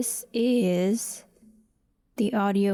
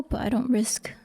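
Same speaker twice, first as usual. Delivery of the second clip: ordinary talk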